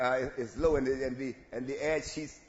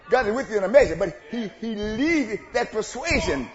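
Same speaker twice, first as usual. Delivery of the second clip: loud, rising preacher's cry; preaching at full force; 5 semitones higher, 13 dB louder than usual. Acted. Preacher calling to the congregation, loud and rising. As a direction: preach it out to the church.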